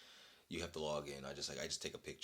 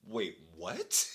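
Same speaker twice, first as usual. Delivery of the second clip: ordinary talk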